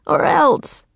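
put-on high-pitched childish threat; defiant young girl. A narrator's reading. Girl threatens the Raven King, high and defiant. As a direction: threaten boldly in a high girl's voice.

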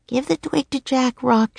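put-on high, gentle girl's voice; high-pitched earnest plea; Annie urging. A narrator's reading. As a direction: coax a friend in a high voice.

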